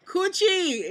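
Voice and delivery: high, loud shout across the room; loud call of a nickname. Retold or imitated. Coworker greeting her from across the store with a high, loud call. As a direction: call out Coochie so the whole store hears. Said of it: "she calls me Coochie".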